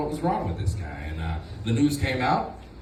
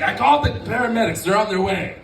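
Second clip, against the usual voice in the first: grandma; 7 semitones higher, 4 dB louder than usual. Retold or imitated. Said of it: "she'd be like".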